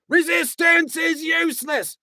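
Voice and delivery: loud dim-witted Vogon guard shout. A narrator's reading. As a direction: bark the stock phrase like a dim brute. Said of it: "the guard shouting again".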